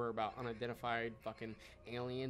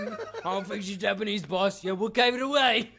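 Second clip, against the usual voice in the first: higher, louder put-on underling; mock henchman voice; 7 semitones higher, 12 dB louder than usual. Voicing the ancient Japanese finding the woman, loud high lackey voice. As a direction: play a dumb lackey reporting to the boss. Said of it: "They're just like".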